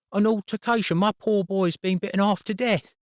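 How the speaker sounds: a mother aghast at her injured son; outraged mother. A narrator's reading. Outraged mother in head's office.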